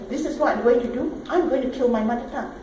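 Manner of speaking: ordinary talk, nothing marked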